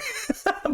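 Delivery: breathy high giggle; a quick airless giggle. Real, not acted. Laughing at her own story.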